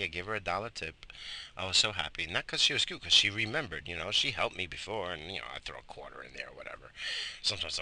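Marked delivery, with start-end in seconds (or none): 5.1-6.9 soft, fast whisper; whispered aside. Real, not acted. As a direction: drop it to a whisper. Side remark dropped low.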